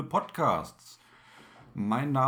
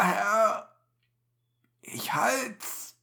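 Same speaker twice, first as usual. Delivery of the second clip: faint deathbed whisper; dying voice; 7 semitones higher, much slower than usual. Acted. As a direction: whisper it with the last breath. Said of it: "mit ersterbender Stimme".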